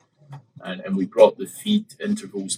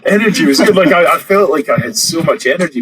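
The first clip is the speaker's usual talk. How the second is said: laughing; 10 semitones higher, 10 dB louder than usual. Real, not acted. Laughing at his own shout.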